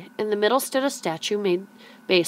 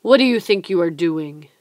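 louder, sharp challenge; demanding; 7 dB louder than usual. A narrator's reading. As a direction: catch the boy in the act. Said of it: "a voice demanded".

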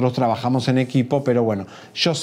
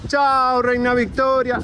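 higher, drawn-out goodbye; mock farewell call; 9 semitones higher, slower than usual. Shown as a demonstration. Reporter demonstrates the goodbye to queen Victoria, mock farewell call. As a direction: send her off like a queen. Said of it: "ahora sí despedimos con así".

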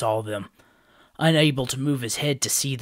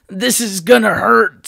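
high, louder comic wince; bracing wince; 10 semitones higher, 11 dB louder than usual. A narrator's reading. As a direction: brace for the crash. Matau bracing for fall.

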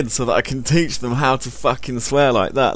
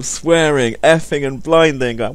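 ordinary talk

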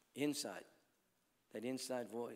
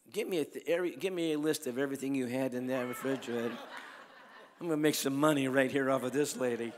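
laughing joke; 8 dB louder, faster than usual. Real, not acted. Joking about making money.

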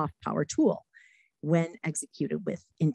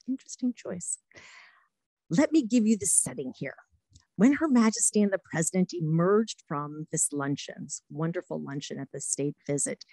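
usual speaking voice

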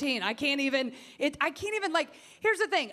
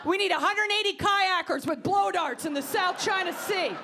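higher, emphatic ranting list; mock Carly ranting; 6 semitones higher than usual. Comic mocking a candidate's wild demands, higher. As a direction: play the candidate demanding absurd things. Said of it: "She's like".

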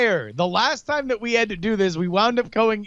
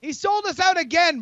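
high, indignant shout; indignant outburst; 10 semitones higher than usual. Acted. Player accusing Brian of trusting a traitor, indignant.

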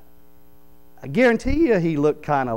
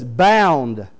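"Bound" hit loud and falling; stress on bound; 6 dB louder than usual. Shown as a demonstration. Preacher contrasts Bound with wrapped, punched. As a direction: punch "Bound" as the strong word. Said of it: "not wrapped".

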